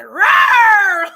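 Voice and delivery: loud retold roar, like Brad; hammed-up angry roar; mock roar. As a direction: roar it out, playfully mad. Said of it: "Like Brad".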